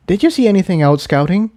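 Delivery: quick, hurried question; asked quickly. Acted. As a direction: rush it to change the subject. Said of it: "Incendia asked quickly".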